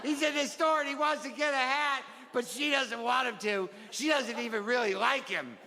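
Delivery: put-on high-pitched little kid telling about a favorite book; child's book report. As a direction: play a giggly child presenting his book.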